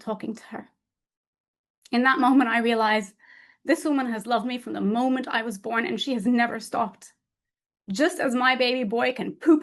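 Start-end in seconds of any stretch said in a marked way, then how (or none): none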